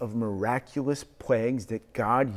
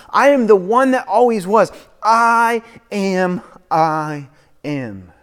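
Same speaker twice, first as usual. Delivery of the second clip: climactic preaching; 3 semitones higher, 10 dB louder than usual. Acted. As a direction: build each I am to a ringing peak.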